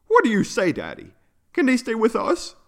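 high little character voice; child asking permission. A narrator's reading. Yuno asks father if friends can stay, hopeful child voice.

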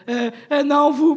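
loud, high startled backpedal; flustered minion. Retold or imitated. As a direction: scramble to correct yourself. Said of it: "Puis il est comme".

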